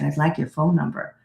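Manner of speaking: a hint of a whisper in her ear; retold whisper. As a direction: softly, close in, a mild hushed request. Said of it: "now he's whispering in my ear".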